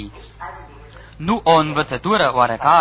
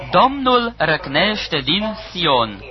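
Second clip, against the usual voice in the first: slow, grave scripture declaration; slow solemn proclamation; slower than usual. Acted. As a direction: draw each word out slowly, weighty. Preacher proclaims the verse.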